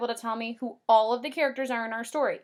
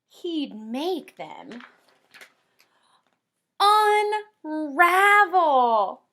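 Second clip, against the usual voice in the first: lively storybook read-aloud, higher and louder; reading to children; 5 semitones higher, 5 dB louder, slower than usual. Acted. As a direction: read the rhyme like a bedtime book for kids. Teacher reads picture book aloud.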